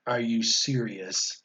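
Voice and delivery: low, slow, hushed incredulous question; stunned disbelief. Retold or imitated. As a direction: imitate a kid too stunned to speak up. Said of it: "And I'm like".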